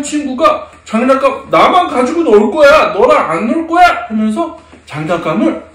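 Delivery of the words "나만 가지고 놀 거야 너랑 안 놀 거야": put-on higher, louder childish refusal; acted playground possessiveness; selfish child. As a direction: shut out a playmate like a bossy kid.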